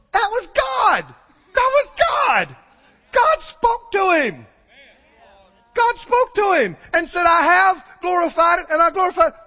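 acted crowd crying out in full-voiced excitement; excited believers. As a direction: shout it high, as if amazed by a miracle. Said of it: "And others are going".

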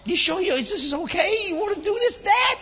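high, loud incredulous questions; acted alarmed doubt. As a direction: question Dad in comic alarm. Retold alarmed questioning of father.

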